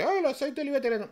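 mock Italian boast, high and rushed; italianos presumiendo. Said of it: "lo que le quieren hacer creer los italianos". (imitación burlona de los italianos, aguda y rápida)

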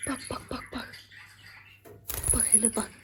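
soft breathy whisper of bak. Real, not acted. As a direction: whisper so as not to startle. Hushed pointing at bird. (fısıltıyla bak bak)

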